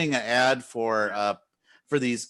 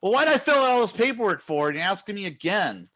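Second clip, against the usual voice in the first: higher, fast, incredulous complaint; exasperated retort; 8 semitones higher, faster than usual. Retold or imitated. Streamer retells arguing with Instagram support, exasperated. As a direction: throw up your hands at the paperwork. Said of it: "It's like".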